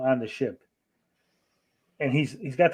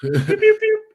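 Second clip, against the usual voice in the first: blaster noises; 8 dB louder, slower than usual. Retold or imitated. Space police shooting noise.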